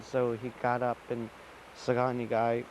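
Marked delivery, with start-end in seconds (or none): none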